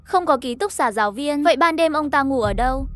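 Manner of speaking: ordinary delivery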